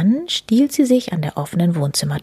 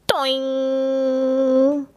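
high springy boing; cartoon boing; 6 semitones higher than usual. A narrator's reading. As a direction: make the bump sound bounce. Stumble sound effect.

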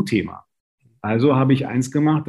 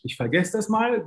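mock meek son; 11 semitones higher, 3 dB quieter than usual.

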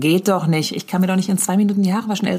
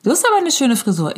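put-on child's voice; 6 semitones higher than usual. Comedian quoting a hotel child in a kid voice. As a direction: imitate the nosy kid. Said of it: "kommt das einzige Kind ... und sagt".

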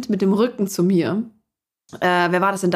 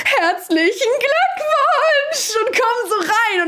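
excited congratulations; much slower than usual. Retold or imitated. Parents burst in congratulating.

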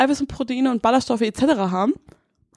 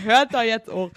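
usual speaking voice

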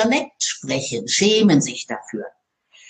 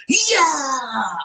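mock stage shout; 5 semitones higher than usual. Mocking men who crouch before speaking, shouting. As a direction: ham up a speaker's big entrance yell. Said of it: "die gehen so in die Knie".

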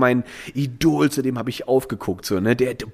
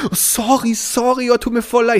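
high, louder gushing sorry; mock barmaid fluster; flustered apology; 9 semitones higher, 4 dB louder than usual. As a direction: apologize like a panicked waitress. Barmaid apologizing after spill.

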